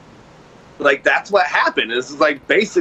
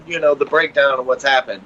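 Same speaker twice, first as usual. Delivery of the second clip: ordinary talk, nothing marked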